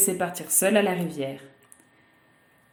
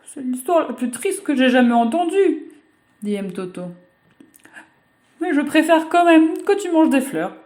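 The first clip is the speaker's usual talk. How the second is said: high, lightly voiced little girl; a child touched by a sad story; 7 semitones higher, 3 dB louder than usual. Girl moved by the crocodile's story, high childish.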